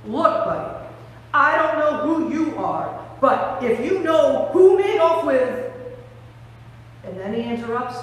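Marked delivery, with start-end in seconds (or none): 0.0-5.7 rising, louder exasperation; exasperated. Acted. Weeping Mary to the stranger at the tomb, exasperated. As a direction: build to a fed-up demand. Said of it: "exasperation in her voice".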